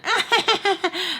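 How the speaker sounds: high fake cackle; put-on rapid high laughter; mock giggling fit. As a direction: imitate two friends bursting out laughing. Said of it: "being all serious and then".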